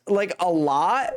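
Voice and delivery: usual speaking voice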